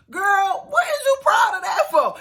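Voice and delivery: high, loud exasperated shout; incredulous shout. Real, not acted. Yelling at Angela about paying for her wedding, shouted disbelief.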